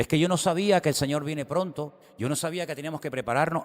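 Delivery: high, fast, pleading excuse; whiny excuse. Retold or imitated. Driver caught speeding, pleading quickly in a high voice. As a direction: rush out a lame excuse to the cop. Acted excuse before the Lord.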